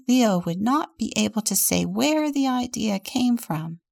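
plain reading voice

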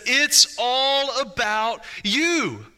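slow, drawn-out, syrupy mock tone; mock flattering voice. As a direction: play the world's coaxing voice, slowly. The world's voice mocked.

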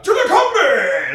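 sudden high laughing shout, slowed; dad suddenly yelling about the boiler; shouted quote. Retold or imitated. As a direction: bellow it out high and drawn-out through a laugh. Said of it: "he's like".

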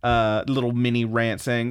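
ordinary talk

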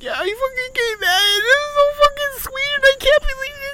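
very high, squeaky tearful wail; hammed-up blubbering; imitating crying sister. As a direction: squeal it through mock sobs. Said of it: "My sister was crying so fucking hard".

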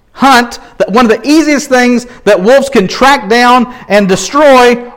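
fiery preaching; building sermon. Acted. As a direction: drive the danger home. Preaching builds about wolves.

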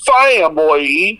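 Family stretched slow in a put-on Southern drawl; fake Southern drawl. Shown as a demonstration. Mocking the coach's fake Southern family. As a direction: drag Family out slowly like a fake Southern accent. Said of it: "said it like".

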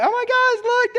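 put-on very high excited little girl voice; toddler voice. Toddler showing dad her tower, squeaky and excited. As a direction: squeal like a proud two-year-old. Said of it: "she was like".

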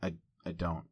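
subdued, wounded retake; downcast reread. Shown as a demonstration. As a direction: read it small and hurt, pausing before the quote. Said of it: "Maybe it's like this".

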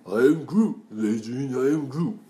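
hammy Groot, loud, to a crowd; bad Groot impression. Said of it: "sounds like Sylvester Stallone doing a bad impression of Groot".

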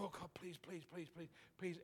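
very soft desperate please; panicked pleading. Retold or imitated. Young prophet silently begging God for words, hushed. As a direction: beg God under your breath. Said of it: "now I'm panicking".

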